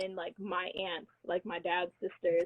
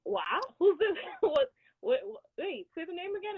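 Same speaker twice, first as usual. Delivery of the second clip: surprised cousin; 9 semitones higher than usual. Retold or imitated. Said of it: "And he goes".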